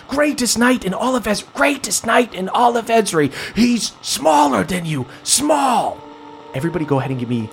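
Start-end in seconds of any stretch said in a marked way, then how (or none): 0.1-6.0 put-on high, eerie distorted echo of a child; warped memory echo. Flashback voices glitching and repeating, high and eerie.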